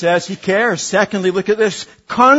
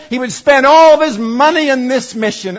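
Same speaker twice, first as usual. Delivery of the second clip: usual speaking voice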